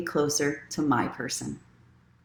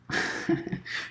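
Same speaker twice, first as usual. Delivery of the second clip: quiet laugh; 8 dB quieter than usual. Real, not acted. Laughing at herself.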